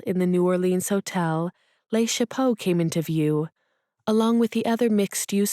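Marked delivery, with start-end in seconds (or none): none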